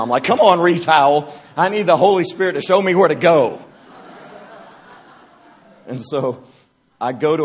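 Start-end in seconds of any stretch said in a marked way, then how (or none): 0.2-3.6 louder, higher exasperated pleading; half-laughing frustrated cry to heaven; frustrated plea. Retold or imitated. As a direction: vent at the sky, fed up. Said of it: "I'm getting frustrated now".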